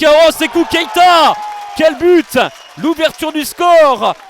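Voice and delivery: roaring out the goal call; excited sports commentary. Real, not acted. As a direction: shout the goal with full excitement. (commentaire sportif survolté)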